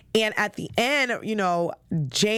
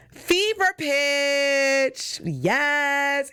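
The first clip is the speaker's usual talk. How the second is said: high, very slow announcing; drawn-out title; 7 semitones higher, much slower than usual. Real, not acted. Host announcing next Boston movie, drawn out. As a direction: stretch the title out like a reveal.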